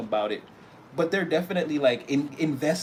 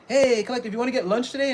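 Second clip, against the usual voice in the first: higher, louder bright invitation; cheery invite; 9 semitones higher, 4 dB louder, faster than usual. Retold or imitated. Coworker inviting the listener to lunch, loud and chirpy. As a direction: call it out eagerly across the office.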